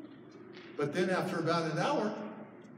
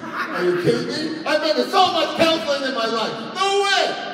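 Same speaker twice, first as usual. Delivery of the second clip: high, loud comic outrage; hammed-up indignant shouting; outraged refusal; 11 semitones higher, 8 dB louder than usual. Retold refusal of counseling.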